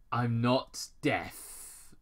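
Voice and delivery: slow, deliberate; Major. Acted. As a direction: spell it out. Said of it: "Major Benjamin interrupted".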